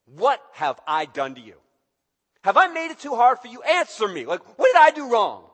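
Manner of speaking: loud, high, hurt demand for an answer; frustrated God. Acted. God confronting his people in court, loud and hurt. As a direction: make God sound wounded and impatient.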